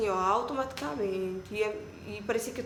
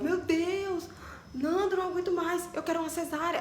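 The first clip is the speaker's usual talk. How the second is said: desperate plea; 8 semitones higher than usual. Retold or imitated. Labor pain, begging husband.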